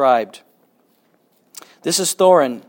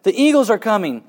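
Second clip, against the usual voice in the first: higher, raised cry of joyful news; excited shout; 7 semitones higher, 7 dB louder than usual. Acted. Bilbo telling the elves help has arrived, excited shout. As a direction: announce the rescue with delight. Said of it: "he shouted".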